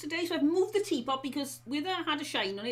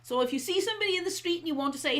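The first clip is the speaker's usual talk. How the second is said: ordinary talk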